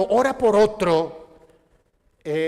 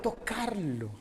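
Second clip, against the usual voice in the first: hushed answer; 10 dB quieter than usual. Shown as a demonstration. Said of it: "¿Que podamos qué?".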